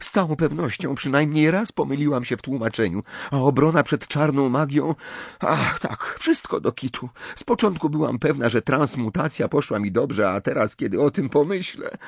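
high, anxious rambling; feverish muttering. A narrator's reading. Hermiona goes through her exams, feverishly fretting. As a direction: fretfully list every imagined failure. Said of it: "mamrotała gorączkowo Hermiona".

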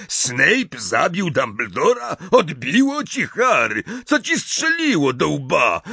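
outraged gamekeeper rejects the claim; incredulous Hagrid. A narrator's reading. Hagrid scolding Harry in disbelief. (Hagrid nie dowierza, głośno i wysoko)

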